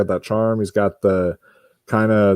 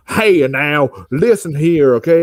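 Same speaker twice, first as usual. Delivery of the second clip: hammed-up country accent, higher and drawn out; John David Washington's country accent; 6 semitones higher, 3 dB louder, slower than usual. Mocking an actor's put-on accent for co-hosts, exaggerated country twang. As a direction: overdo a slow country-boy accent. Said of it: "trying to put on this accent".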